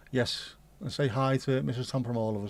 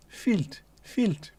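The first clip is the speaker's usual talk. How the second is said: higher, falling squeak; mock high voice; 8 semitones higher than usual. Answering the chat in a high comic squeak.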